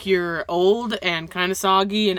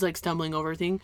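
ordinary delivery

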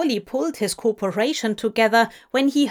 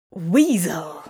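1 muttered hiss; 4 semitones lower than usual. A narrator's reading. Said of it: "Crowley hissed to himself".

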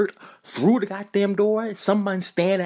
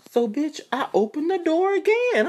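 ordinary delivery

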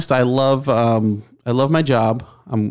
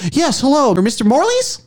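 much higher phone-caller voice; telemarketer; 14 semitones higher than usual. Retold or imitated. Random caller asking for the man of the house, telemarketer. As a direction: imitate a cold caller checking the name. Impression of telemarketer after pause.

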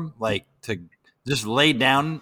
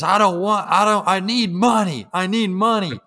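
higher, louder pleading; imitated desperate plea; 7 semitones higher, 5 dB louder than usual. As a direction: beg insistently like the homeless man. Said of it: "And he goes".

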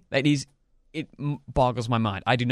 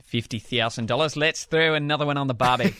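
hammed-up Australian accent, a bit off; 3 semitones higher than usual. Shown as a demonstration. Cohost asked for a phrase, he answers in mock Australian accent. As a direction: play the Aussie bloke at a barbecue, mock Australian. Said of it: "Wanna hear my best Australian accent?".

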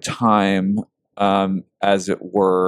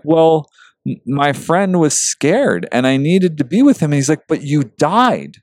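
choked up; 6 semitones higher than usual. Retold or imitated. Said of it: "oh, I'm gonna get so emotional".

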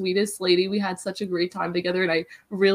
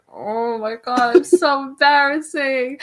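embarrassed laughing; 5 semitones higher, 7 dB louder, slower than usual. Retold or imitated. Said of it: "I'm like".

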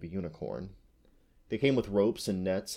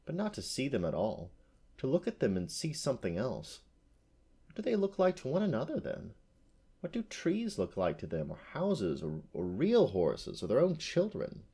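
softer, rising incredulous questions; bewildered wondering; 5 semitones higher, 3 dB quieter than usual. A narrator's reading. Unicorn wondering how men fail to see her, bewildered. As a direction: puzzle aloud, pitch swinging upward. Unicorn ponders men's blindness.

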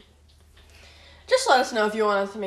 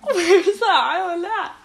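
laughing; 8 semitones higher, 7 dB louder than usual. Real, not acted. Laughing follow-up question.

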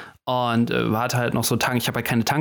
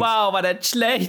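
sarcastic; 9 semitones higher, much slower than usual. Acted. Mocking the bad joke.

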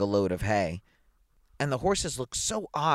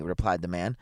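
plain reading voice

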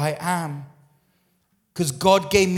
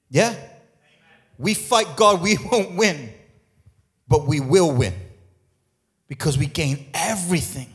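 ordinary talk

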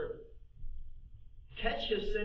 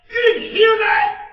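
even higher, full-throated shouted question; 21 dB louder than usual. Acted. David roaring the question at Eliab. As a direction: shout it in outrage.

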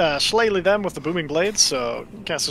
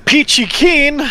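sarcastic; 8 semitones higher, 7 dB louder, slower than usual. Real, not acted. Sarcastic after missing.

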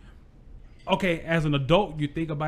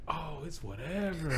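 low menacing line; 12 dB quieter, slower than usual. Retold or imitated. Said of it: "I was like".

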